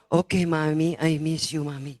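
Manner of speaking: low-pitched affectionate sign-off; put-on man's voice; grown man's voice. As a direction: imitate a grown son on the phone. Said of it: "suaranya kayak om-om".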